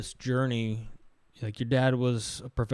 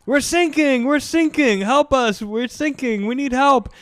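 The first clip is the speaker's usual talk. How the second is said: high, loud cries for help; mock panicked plea; 12 semitones higher, 8 dB louder than usual. Sailor begging coast guard for rescue, mock panic. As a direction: shout it like a hammed-up drowning sailor. Acted distress call from sinking boat.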